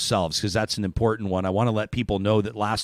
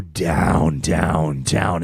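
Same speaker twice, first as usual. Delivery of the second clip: voice sinking down; 6 semitones lower, 4 dB louder, much slower than usual. Acted. Evoking whales diving deeper.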